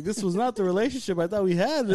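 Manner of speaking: usual speaking voice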